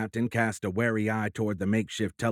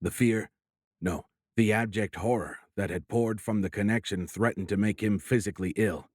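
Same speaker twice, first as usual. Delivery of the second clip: ordinary delivery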